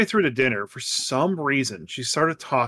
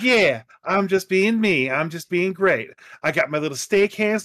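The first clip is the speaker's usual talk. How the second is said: playful romanticized Southern voice; Southern accent; 5 semitones higher than usual. Retold or imitated. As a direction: imitate a daughter hamming up a Southern accent. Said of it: "romanticized Southern accent, like [name]".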